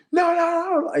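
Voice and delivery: high, panicked retold cry; mock panic. Player in another shoving match at the door, mock panic. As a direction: protest in a high voice, acting out panic.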